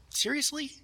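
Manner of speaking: high, breathy disbelief; soft, high incredulous seriously. Retold or imitated. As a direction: act stunned at the question. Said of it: "where you're just like".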